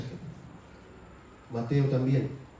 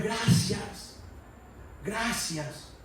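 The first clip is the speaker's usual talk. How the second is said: relieved thanks; 6 semitones higher, much slower than usual. Retold or imitated. Quoting own relieved thanks.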